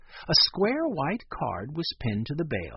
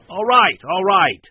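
loud, falling give-in; exasperated surrender; 5 semitones higher, 12 dB louder than usual. A narrator's reading. Man opening up after a threat, exasperated. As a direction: give in under pressure.

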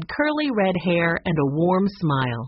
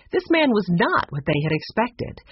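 ordinary delivery, nothing marked

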